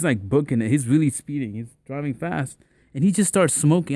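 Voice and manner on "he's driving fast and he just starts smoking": usual speaking voice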